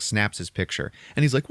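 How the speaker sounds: ordinary talk, nothing marked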